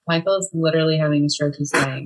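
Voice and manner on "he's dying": ordinary delivery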